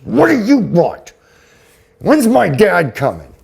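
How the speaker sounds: put-on high, loud, indignant demand from an elderly mother; angry old mother. Elderly mother demanding her dad, high and loud. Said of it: "and she was angry".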